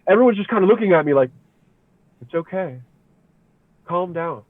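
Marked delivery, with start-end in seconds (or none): 2.2-4.4 soft, hushed reassurance; calm him down gently and quietly; soothing. Retold or imitated. Loved ones soothing him.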